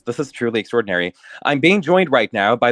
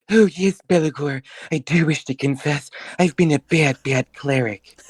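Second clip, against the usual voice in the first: sultry courtesan; 4 semitones higher than usual. Acted. Courtesan Titania voiced.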